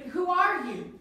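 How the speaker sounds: high, loud "Who are you?"; challenging call. Shown as a demonstration. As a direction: demand it loud. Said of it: "You have to be like".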